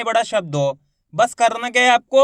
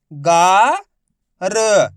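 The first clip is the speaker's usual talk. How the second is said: drawn out; 5 dB louder, much slower than usual. Shown as a demonstration. Teacher shows how to stretch गा, drawn out. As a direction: stretch the गा long and louder. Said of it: "तो खींच के पढ़ेंगे कैसे".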